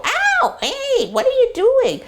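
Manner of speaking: very high squeaky puppy protest. Pretend. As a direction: squeal it like an offended newborn puppy. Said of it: "to get the puppy to go".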